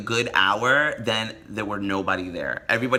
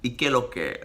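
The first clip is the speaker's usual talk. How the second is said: Dominican accent; 3 dB quieter than usual. Shown as a demonstration. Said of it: "They always say that word".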